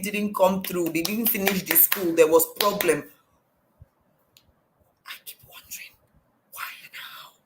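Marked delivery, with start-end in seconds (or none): 5.1-7.3 hushed whisper of wonder; a quiet, puzzled whisper. Real, not acted. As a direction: whisper the question to yourself. Musing on people's lies.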